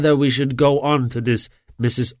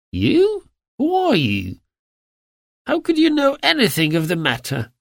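surprised; 11 semitones higher than usual. A narrator's reading. Startled man questions Holmes.

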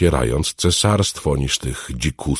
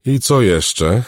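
ordinary delivery, nothing marked

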